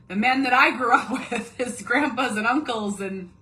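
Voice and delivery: laughing through the words. Real, not acted. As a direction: chuckle while talking. Laughing while describing men.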